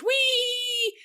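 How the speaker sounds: put-on long high held wee, sung out like a kid on wheels; playful wee. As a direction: stretch the wee out high and steady. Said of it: "You have to make that noise".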